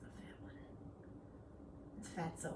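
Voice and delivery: soft whisper. Real, not acted. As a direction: whisper the comment. Whispered comment.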